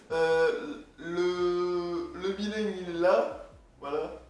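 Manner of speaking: ordinary talk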